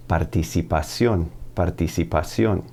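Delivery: "Participación" with pure Spanish vowels. Shown as a demonstration. Teacher models the noun for learners, Spanish vowels. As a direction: say "Participación" as Spanish, stressed on the last syllable. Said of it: "it's all coming down to pronouncing those vowels".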